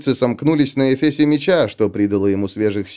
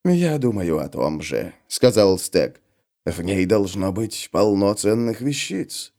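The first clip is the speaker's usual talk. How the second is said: lower, softer scheming voice; low character voice; 4 semitones lower, 3 dB quieter, slower than usual. Acted. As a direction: voice Stag low and sly. Stag speaks in the story.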